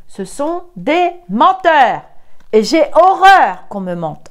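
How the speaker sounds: scolding the liars; indignant. Real, not acted. Tarot reader denouncing liars to viewers, indignant and loud.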